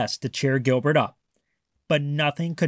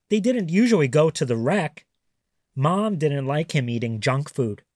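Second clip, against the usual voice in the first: plain reading voice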